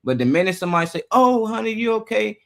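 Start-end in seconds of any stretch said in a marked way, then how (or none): 1.1-2.4 put-on high-pitched cooing over a child; mock fussing concern. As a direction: imitate an overprotective parent's worry. Imitates fussing adult after fall.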